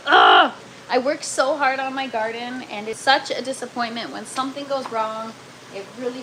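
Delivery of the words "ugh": loud low groan of dismay. Real, not acted. Gardener to live viewers after breaking plant, low loud groan.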